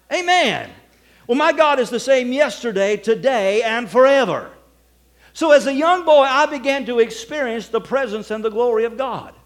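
loud, ringing preacher's proclamation; preaching at full force. Acted. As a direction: proclaim it to the whole church. Preaches to the congregation.

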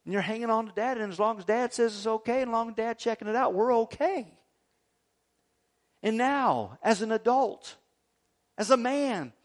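ordinary talk, nothing marked

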